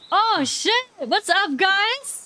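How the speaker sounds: loud, high, excited greeting; excited yelp of recognition; thrilled surprise. Real, not acted. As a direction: burst out on spotting long-lost friends. Sees lost game friends arrive.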